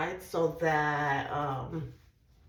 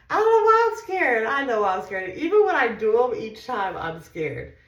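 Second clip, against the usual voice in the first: much higher and louder, a nervous near-wail; scared outburst; 6 semitones higher, 11 dB louder than usual. Real, not acted. To viewers before her shot, a loud frightened outburst. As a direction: let real injection fear burst out loud and high. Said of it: "Y'all, I'm scared".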